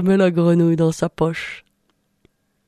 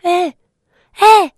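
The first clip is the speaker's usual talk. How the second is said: very high, loud squeals; frog shouting; 9 semitones higher, 8 dB louder than usual. Acted. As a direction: scream to be let out of the pocket. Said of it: "elle fait".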